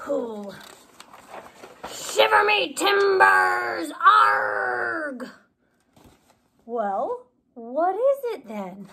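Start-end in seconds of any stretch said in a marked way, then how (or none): 1.9-5.3 loud, slow mock pirate cry; playful pirate shout; hammed-up pirate. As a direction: ham it up like a pirate. Pirate play.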